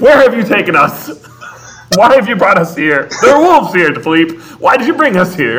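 hammed-up frightened inventor; mock panic. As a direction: Maurice crying out at Philippe, in mock panic. Acting Maurice scared by wolves.

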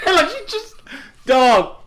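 normal talking voice

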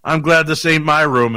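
loud, high mock dismay; imitated freaked-out buddy; alarmed reaction. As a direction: blurt it loudly like a spooked friend. Shane's alarmed reaction acted out.

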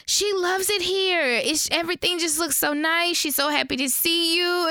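high, sugary daughter voice; fake sweet translation. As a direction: paper over it with bright high praise. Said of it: "Bae is like".

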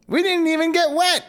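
put-on high, delighted child's voice; excited little boy. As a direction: brag like a gleeful kid. Hansel laughing, child voice.

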